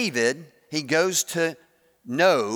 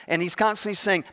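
ordinary delivery

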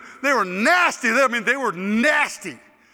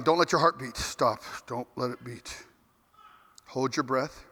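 low, very soft aside; hushed self-urging; 8 semitones lower, 6 dB quieter than usual. Retold or imitated. Players hoping not to be called next, hushed tense murmur. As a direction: barely voice it, as if hiding from notice.

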